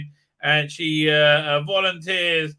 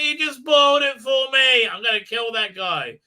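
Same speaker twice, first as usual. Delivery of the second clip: high, loud indignant wail; mock outrage; 8 semitones higher, 7 dB louder than usual. [name] reacting to a Sopranos spoiler, hammed-up outrage. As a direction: cry out as if robbed of the ending.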